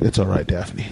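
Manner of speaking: lightly voiced charmer comforting her; a hint of a suave hero; ladies' man. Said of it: "It's like".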